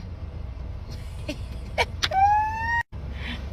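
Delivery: high, quiet wheezing laugh. Real, not acted. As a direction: break into a thin squealed laugh. Laughs at the patois text.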